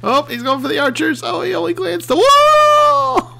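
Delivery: high squeal building to a whoop; excited commentary. Real, not acted. Player follows his machine toward archers, excited.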